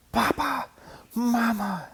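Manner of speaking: high, breathless, bursting calls; gasping excited cubs. A narrator's reading. Cubs bursting in to their parents, gasping and excited. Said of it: "they gasped".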